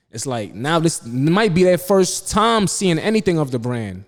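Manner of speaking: exasperated scolding. Retold or imitated. Acted scolding of models filming early.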